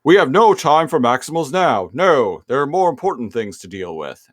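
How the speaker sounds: imperious villain brushing off a question; Megatron. Acted. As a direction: dismiss an underling with theatrical impatience. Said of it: "And Megatron's like".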